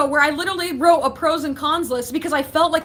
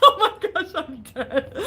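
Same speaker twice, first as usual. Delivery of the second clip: real fit of giggles, voice tailing off; laughing; 2 semitones higher than usual. Real, not acted. Streamer reacting to funny chat comments, helpless laughter.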